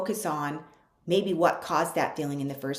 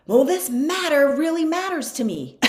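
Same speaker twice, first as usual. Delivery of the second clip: high, loud, whining protest; whiny younger self; 9 semitones higher, 8 dB louder than usual. Retold or imitated. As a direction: complain like a frustrated kid arguing with mom. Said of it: "and I'd be like".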